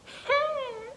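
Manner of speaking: short high laugh at herself; nervous high chuckle; rueful laugh. Real, not acted. Laughs at own failure.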